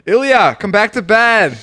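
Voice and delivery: hammed-up plea to come back; teasing a roommate as he leaves, loud mock call. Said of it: "I go".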